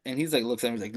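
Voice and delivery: ordinary talk, nothing marked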